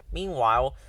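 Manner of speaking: ordinary delivery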